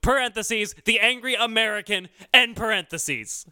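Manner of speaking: high, emphatic patriotic rant; mock angry American. Host defending his national pride on the show, mock angry. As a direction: refuse to apologize, indignant and punched. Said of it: "Now I'm an angry American".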